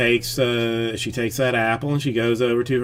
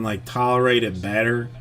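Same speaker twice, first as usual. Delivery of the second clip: ordinary talk, nothing marked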